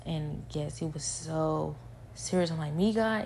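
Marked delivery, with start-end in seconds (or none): 2.7-3.3 high, louder disbelief; retold alarmed double-check; startled question. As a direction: ask as if suddenly singled out. Startled question back to God.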